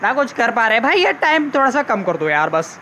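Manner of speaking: normal talking voice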